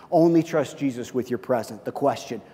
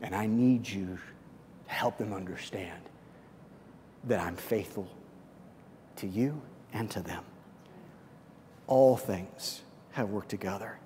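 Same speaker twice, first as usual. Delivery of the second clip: God's voice, hushed; 6 semitones lower, 14 dB quieter than usual. Retold or imitated. Pastor voicing God's gentle reply to him, hushed. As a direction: speak it like a quiet answer from above. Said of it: "And he said".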